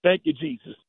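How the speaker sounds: ordinary delivery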